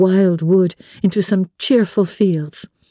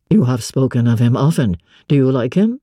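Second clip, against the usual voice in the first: a lower gentleman, asking pointedly; low male voice, probing; 7 semitones lower than usual. A narrator's reading. Master quizzing Jane about her cousin, low and probing. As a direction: voice the master, low, edging into jealousy.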